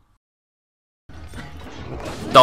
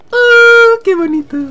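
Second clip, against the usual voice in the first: very high squealed aww; hammed-up adoring squeal; gushing coo; 7 dB louder than usual. Coos at a loving pair.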